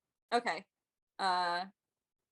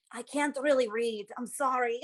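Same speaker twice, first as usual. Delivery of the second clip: lightly voiced sheepish admission, a little higher; apologetic cleric; 3 semitones higher than usual. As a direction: confess it with a mild apology. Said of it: "She's just gonna be like".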